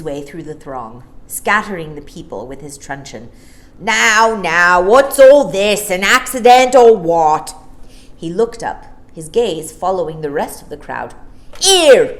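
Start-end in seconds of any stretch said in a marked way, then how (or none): 3.8-7.5 loud, bluff policeman voice; a loud policeman demanding what's going on. Acted. Policeman pushing through the crowd. 11.7-12.2 loud angry higher shout; angry call. A narrator's reading. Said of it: "he called angrily".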